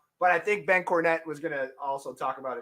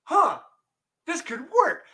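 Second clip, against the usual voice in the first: rising excitement; 10 semitones higher than usual. Retold or imitated. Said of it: "And I go".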